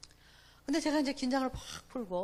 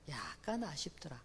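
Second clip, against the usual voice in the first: whisper; 7 semitones lower, 8 dB quieter than usual. Retold or imitated. Husband's deflating afterthought.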